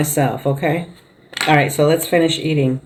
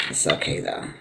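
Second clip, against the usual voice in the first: breathy whisper, much softer, said to herself; whispered aside; 13 dB quieter than usual. Real, not acted. Eating alone, quietly whispering reassurance to herself. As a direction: whisper it under your breath like a private aside.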